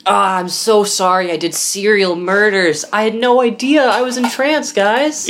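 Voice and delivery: high, sheepish fake apology; mock innocent killer. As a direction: play a killer acting innocent. Pretends to be a murderer.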